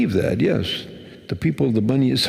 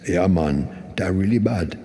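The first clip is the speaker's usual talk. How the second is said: put-on a light Trinidadian accent, lower; imitating the Trinidadian store owner's lilt; a hint of a Trinidadian accent; 6 semitones lower than usual. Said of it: "they have their own kind of interesting accent".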